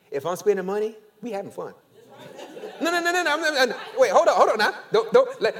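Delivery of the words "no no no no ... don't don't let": laughing protest. Real, not acted. Laughing stop to the crowd.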